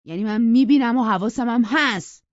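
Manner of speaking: put-on jealous young man firing back; sharp retort. A narrator's reading. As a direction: warn her, stung. Heathcliff retorts in quarrel.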